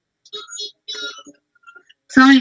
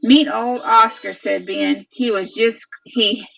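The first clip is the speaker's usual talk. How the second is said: storybook read-aloud voice to little ones; 3 semitones higher than usual. Real, not acted. Mother reading a farm book to her children, storybook voice.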